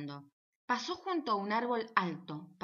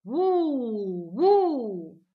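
very loud boo echoed back; answering boo; 2 semitones higher, 10 dB louder than usual. Acted. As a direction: boom it right back from behind the tree. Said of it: "alguien desde atrás del árbol amarillo repitió".

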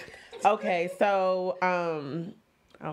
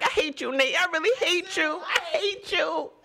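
laughing mock hate; 8 semitones higher than usual. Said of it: "She's like".

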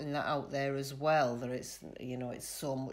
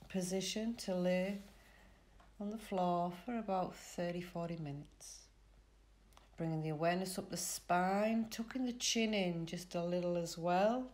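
calm relaxation guide with long pauses; soothing Yoga Nidra voice, spaced phrase by phrase; guided meditation; 3 semitones higher, 3 dB quieter than usual. Real, not acted. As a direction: lead them gently into rest, unhurried.

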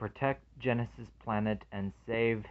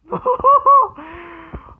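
laugh; 12 dB louder than usual. Real, not acted. Laughing after quoting the shout.

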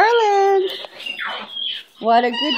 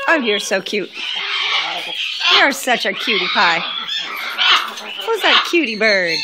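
low cooing; 7 semitones lower, faster than usual. Real, not acted. Cooing at pet bird.

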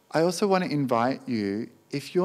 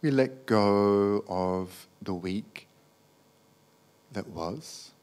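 guided meditation; 3 semitones lower, 5 dB quieter than usual. Acted. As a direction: gently guide them into letting go, unhurried.

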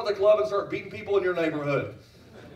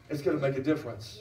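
ordinary talk, nothing marked

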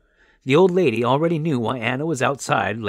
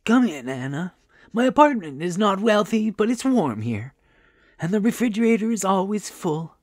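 put-on higher-pitched, welcoming old lady; hospitable old woman; 9 semitones higher, 4 dB louder than usual. A narrator's reading. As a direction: reassure a guest as a kindly host. Old woman welcomes her guests.

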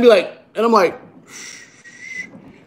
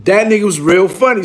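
usual speaking voice

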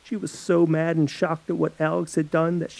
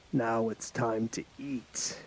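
soft, slow, menacing murmur; sinister hush; 4 semitones lower, 9 dB quieter, slower than usual. Acted. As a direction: let the threat sink in quietly. Mortician's sinister threat.

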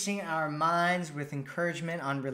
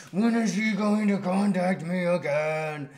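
a fretful woman staring at her phone, slightly higher and louder; mock anxious girlfriend; 4 semitones higher, 6 dB louder than usual. Woman waiting by her phone for his text, mock fretting. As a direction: play up the needy worry, light and mocking. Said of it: "sitting there by your phone going".

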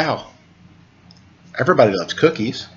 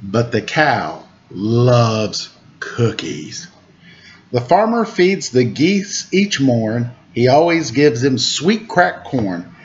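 lilting storybook rhymes, slow refrain; reading to children; slower than usual. A narrator's reading. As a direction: read the rhyme aloud to little listeners. Reads picture book to children.

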